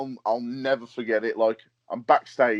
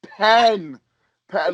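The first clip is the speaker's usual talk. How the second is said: higher, louder and slow, insisting on pen; exasperated slow repeat; 10 semitones higher, 7 dB louder, slower than usual. Retold or imitated. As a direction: spell it out, exasperated, to someone who won't get it. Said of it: "he's like".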